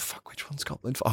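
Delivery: a whispered, flustered "which one's goblin four"; whispered panic. Retold or imitated. GM who lost track of goblins, whispering in panic. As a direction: whisper it like you've lost the count. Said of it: "I'm always like".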